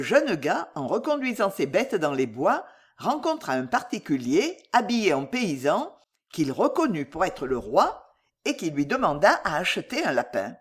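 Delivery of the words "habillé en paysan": ordinary delivery, nothing marked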